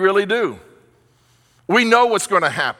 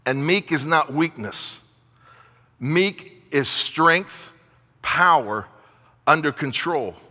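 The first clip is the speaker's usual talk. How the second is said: slow emphasis; 4 semitones lower, slower than usual. Real, not acted. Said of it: "slow emphatic definition of meek".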